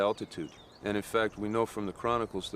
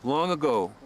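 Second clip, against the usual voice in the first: higher-pitched, deliberate storyteller's voice; myth storytelling; 6 semitones higher than usual. Acted. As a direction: tell it like an old legend, phrase by phrase. Tells a myth aloud.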